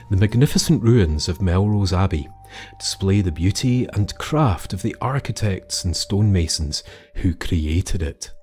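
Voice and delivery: usual speaking voice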